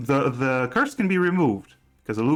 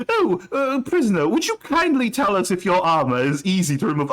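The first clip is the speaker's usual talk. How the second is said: higher, quicker put-on accented voice; mock guard questioning; 7 semitones higher, 3 dB louder than usual. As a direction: put on a polite, high-pitched guard addressing a prisoner. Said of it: "that was my, my Gala impression. I can't do that accent".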